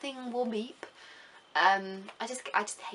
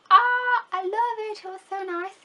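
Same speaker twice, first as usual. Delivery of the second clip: excited gushing; 11 semitones higher, 9 dB louder than usual. Real, not acted. Gushing about Stockholm.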